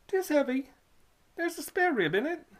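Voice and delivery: put-on high, eager young boy; a country lad answering, pitched high; boy's voice. Boy answering the toll keeper, high and eager.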